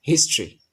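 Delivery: হিস্ট্রি in two syllables, "HIS-tri", falling; correct pronunciation. Shown as a demonstration. Teacher modelling the correct form, হিস্ট্রি as "his-tri". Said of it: "এটা সঠিক উচ্চারণ হচ্ছে".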